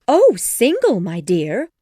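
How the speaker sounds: high, loud delighted cry; thrilled wife, soaring then settling; excited. A narrator's reading. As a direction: gush excitedly to your husband. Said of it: "cried Missus Bennet excitedly".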